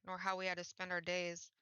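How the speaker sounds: ordinary delivery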